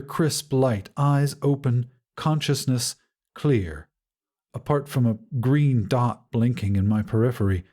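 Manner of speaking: plain reading voice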